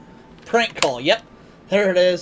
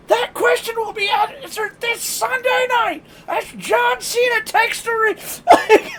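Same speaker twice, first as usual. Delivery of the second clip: higher, louder announcer shout, slowed; hype announcer; 3 dB louder, slower than usual. Retold or imitated. Retelling the prank radio call, as the hyped wrestling announcer. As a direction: boom it out like a wrestling promo.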